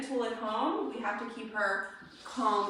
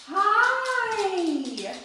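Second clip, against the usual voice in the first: excited dog greeting; 9 semitones higher, 6 dB louder than usual. Real, not acted.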